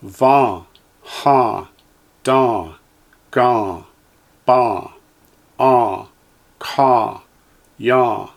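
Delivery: each syllable said slowly and clearly, with a pause after it; teacher's careful drill voice; Hebrew syllables for echoing. Shown as a demonstration. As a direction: model every Hebrew sound for the class to repeat. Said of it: "Line eight".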